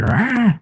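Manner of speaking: high warning yowl; imitated animal snarl. Owner imitating his pet refusing touch, mock snarl. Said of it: "He'd just be like".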